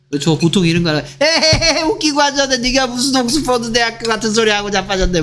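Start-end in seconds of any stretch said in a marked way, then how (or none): 1.2-5.2 high-pitched jeering voice with a scoffing laugh up front; mocking sibling. Sibling scoffing at the Oxford dream, high mocking jeer. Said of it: "뭐 이럴 텐데".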